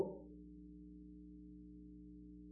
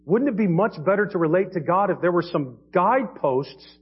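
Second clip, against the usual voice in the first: ordinary talk, nothing marked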